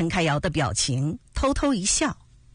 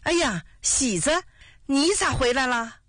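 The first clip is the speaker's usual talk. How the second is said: father's delighted, higher-pitched greeting; happily surprised; 5 semitones higher than usual. A narrator's reading. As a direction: greet your son with pleased surprise. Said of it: "高兴地说". (又惊又喜地招呼)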